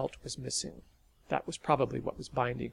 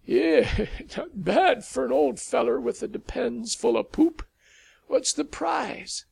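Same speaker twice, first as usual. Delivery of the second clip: put-on higher old man's voice chuckling; laughing old man; 10 semitones higher, 7 dB louder than usual. A narrator's reading. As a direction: laugh it off like a cheeky old codger.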